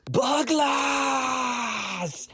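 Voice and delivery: high, drawn-out screaming charge; battle cry. Acted. Bug bursting into the raiders' camp, howling. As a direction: howl it as you burst in.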